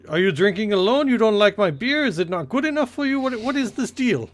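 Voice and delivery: Russian sailor, offended and pushy, rising in pitch; Russian accent. Acted. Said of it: "I don't know why he's Russian".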